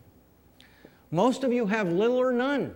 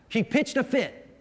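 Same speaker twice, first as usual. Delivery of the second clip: slow, higher North Carolina drawl, "fit" stretched into two syllables as "fi-it"; 5 semitones higher than usual. Retold or imitated. Pastor imitates how folks in North Carolina say it, slow North Carolina drawl. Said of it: "in North Carolina, it was two syllable".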